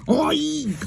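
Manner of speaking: mournful oink. A narrator's reading. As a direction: wail it like a sad pig. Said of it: "wailed Mister Grumble, mournfully".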